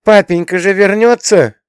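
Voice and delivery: put-on high, loud child's voice; little boy. A narrator's reading. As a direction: cry it out like an insistent small boy. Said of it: "ответил мальчик".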